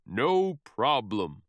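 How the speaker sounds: easygoing monster boom. Acted. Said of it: "booms the monster".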